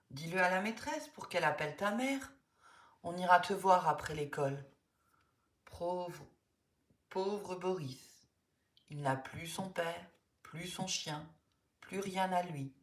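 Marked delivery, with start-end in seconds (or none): 5.7-12.7 soft, slow, sorrowful murmur; grieving softly for a friend; sad pity. Acted. Friends pity Boris who lost father and dog, softly sad.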